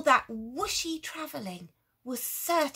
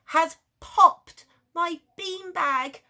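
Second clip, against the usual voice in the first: put-on higher, loud childish complaint; upset child; 7 semitones higher, 9 dB louder, slower than usual. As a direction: cry it out like a wronged kid. Little person outraged.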